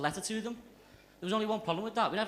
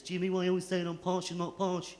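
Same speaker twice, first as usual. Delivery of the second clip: put-on posh girlfriend voice. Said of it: "That's how she talks".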